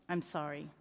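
very soft, low voice; hushed father comforting his child; tender apology. A narrator's reading.

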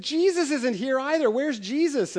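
put-on high, worried child's voice; a light take on an upset four-year-old; upset little girl. Pastor voicing a little girl who can't find God, high and fretful.

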